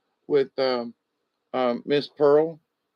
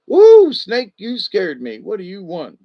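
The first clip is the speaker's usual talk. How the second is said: startled cry; 6 semitones higher, 8 dB louder than usual. Acted. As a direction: jump at the fright, then ask. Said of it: "the Lizard cried".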